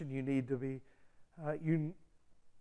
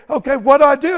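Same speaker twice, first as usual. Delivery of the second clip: anxious; 9 semitones higher, 19 dB louder, faster than usual. Retold or imitated. Driver asks, raised voice.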